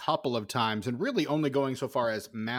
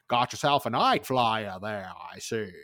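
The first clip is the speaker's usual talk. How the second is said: folksy Maine accent impression; discount Fred Gwynne; 3 semitones lower than usual. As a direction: lightly imitate an over-the-top Maine old-timer. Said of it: "giving discount Fred Gwynn from Pet Sematary".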